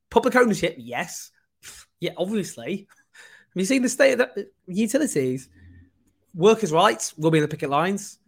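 ordinary talk

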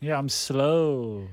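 very slow, dragged words; mock slowness. Owning up to co-hosts, dragged very slowly. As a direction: draw it out slowly.

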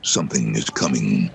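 low, ominous promo read; deep trailer narrator building suspense; typical trailer voice. Retold or imitated. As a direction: intone it like a movie trailer. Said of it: "it's, it's typical".

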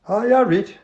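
high, loud Geordie aye; Geordie accent. Acted. Clive answering Tony, Geordie. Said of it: "an authentic, uh, Northerner".

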